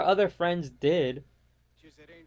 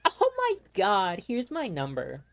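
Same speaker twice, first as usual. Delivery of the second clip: mimicked smitten girl. Mimicking girls charmed by the guys.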